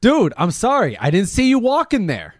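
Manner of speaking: loud, high-pitched acted apology; improvised panicky sorry; flustered apology. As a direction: protest your innocence at full volume. Improv scene, apologising to angry victim.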